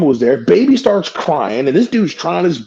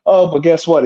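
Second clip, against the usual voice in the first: ordinary talk, nothing marked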